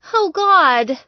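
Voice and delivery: a loud, high startled cry; alarmed cry. Acted. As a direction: yelp as things go flying. Knocking things over.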